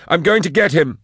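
high, loud, urgent; determined. Acted. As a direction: declare it while rushing off. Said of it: "said Drusha".

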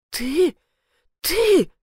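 high, halting outraged repeats; lost for words. A narrator's reading. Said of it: "Она не находила слов". (не находя слов)

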